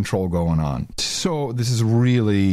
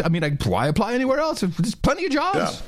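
higher, rushed gushing voice; mock naive lady; 8 semitones higher than usual. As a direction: gush as a gullible lady, pitch climbing. Acts the naive lady again.

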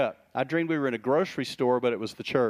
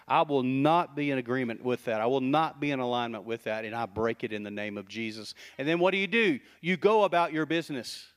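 usual speaking voice

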